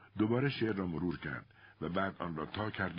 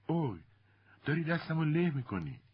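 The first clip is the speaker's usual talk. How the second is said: much higher-pitched complaint; pained protest; 10 semitones higher, 3 dB louder than usual. A narrator's reading. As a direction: yelp it as your hand gets squeezed. Matty protests her hand is squeezed. (اعتراض با صدای زیر)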